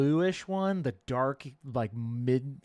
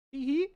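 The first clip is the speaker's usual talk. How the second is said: high giggle; 13 semitones higher, 4 dB louder than usual. Pretend. Giggling tease after teasing.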